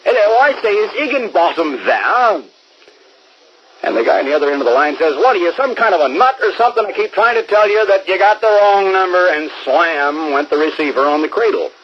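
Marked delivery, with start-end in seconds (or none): 0.0-2.4 insistent repeat. Retold or imitated. Prankster caller pressing the stranger again, insistent and higher. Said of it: "And he says". 5.2-9.3 higher, faster exasperated snapping; irritated man fed up with a nuisance caller; annoyed stranger. Retold or imitated. Stranger snapping at the repeat caller, exasperated and fast.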